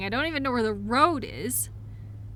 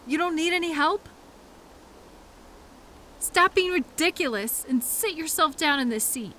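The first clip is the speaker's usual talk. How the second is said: ordinary talk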